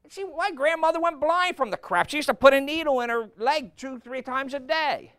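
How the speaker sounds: loud, high, driving sermon voice; preaching at full force. Acted. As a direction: hammer it home from the pulpit.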